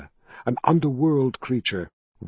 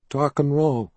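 put-on weak child voice; 3 semitones higher than usual. A narrator's reading. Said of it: "the boy answered in a weak voice".

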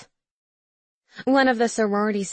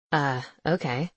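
male character voice; 6 semitones lower than usual. A narrator's reading.

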